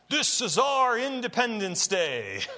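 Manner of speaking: lightly voiced stirring president rallying the troops; inner Abraham Lincoln. Film president before the final attack, mild rousing speech. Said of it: "I feel my inner Abraham Lincoln coming out there".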